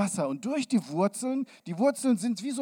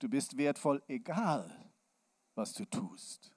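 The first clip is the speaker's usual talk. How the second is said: tender; 4 semitones lower, 6 dB quieter than usual. Acted. Tender assurance to child.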